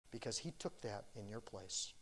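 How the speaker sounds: normal talking voice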